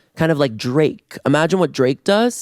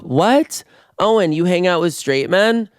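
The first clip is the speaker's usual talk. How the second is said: mock shock; 5 semitones higher than usual. Mock audience reacting to cast news.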